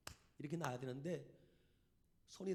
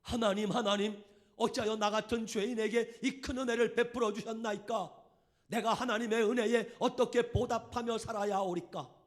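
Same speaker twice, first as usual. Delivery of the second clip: loud, much higher, pleading; crying prayer; 6 semitones higher, 12 dB louder than usual. Retold or imitated. Leper man repeating his prayer aloud, tearful wailing. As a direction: cry the same words out again, begging. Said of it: "얼굴 형체도 없는 저런 분도".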